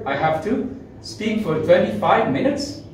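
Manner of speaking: "I have to speak for twenty-five minutes" high, with a strong lift on "minutes"; emphatic, high-pitched questioning echo; emphasizing. Shown as a demonstration. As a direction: lean on "twenty-five minutes" and end on a rise. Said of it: "he is emphasizing".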